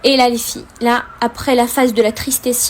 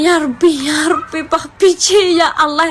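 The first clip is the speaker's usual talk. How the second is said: retold crying prayer; 3 semitones higher, slower than usual. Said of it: "j'étais en train de pleurer".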